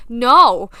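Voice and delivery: a sudden loud no; emphatic no. Real, not acted. Correcting viewers' assumption with a loud, firm no. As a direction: cut the thought off with a loud no.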